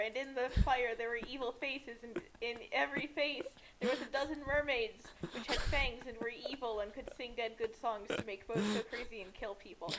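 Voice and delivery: ordinary talk, nothing marked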